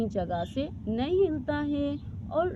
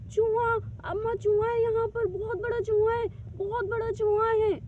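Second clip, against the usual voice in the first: put-on frightened girl; 8 semitones higher than usual. Rani reports the mouse, scared.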